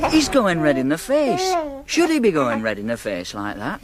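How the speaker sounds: put-on anxious rising pitch over the baby; a father panicking at the cot, high and worried. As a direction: fret nervously, voice climbing.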